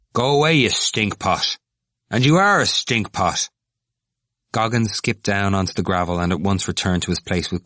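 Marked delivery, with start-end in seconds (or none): 0.1-3.5 higher, emphatic name-calling; rude insult. A narrator's reading. Cranley driving off the stout student, rude. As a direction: throw the insult at him rudely. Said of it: "he said rudely".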